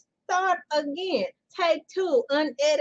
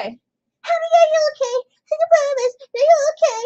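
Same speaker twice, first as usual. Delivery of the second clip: much higher and louder squeaky singing; chipmunk voice; 11 semitones higher, 7 dB louder than usual. Pretend. Mocking the chipmunk singers at the song's end, high and louder. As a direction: sing it like a sped-up chipmunk. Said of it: "the people at the end start sounding like chipmunks".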